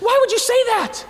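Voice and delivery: hammed-up shocked outrage; shocked friend. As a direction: react like you've been wrongly accused. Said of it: "She's like".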